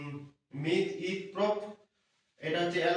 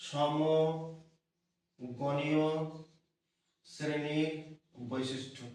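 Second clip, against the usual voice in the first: সমগণীয় dragged out very slowly, then fading; slow dictation; much slower than usual. Shown as a demonstration. Teacher dictating an important heading to students. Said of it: "খুব important".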